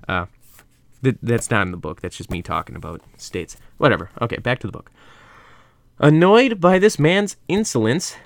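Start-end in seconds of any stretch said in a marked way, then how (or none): 6.0-8.2 slow, raised reading voice; story narration. A narrator's reading. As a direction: read it like a dramatic storyteller. Reads the story aloud.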